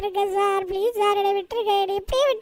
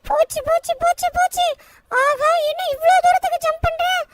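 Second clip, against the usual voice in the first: higher, childlike cartoon boy voice, panicking; Shinchan cartoon voice; 6 semitones higher than usual. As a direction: play a frantic little cartoon kid. Shinchan voice over the game.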